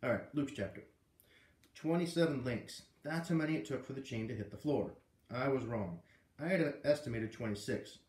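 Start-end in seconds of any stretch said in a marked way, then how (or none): none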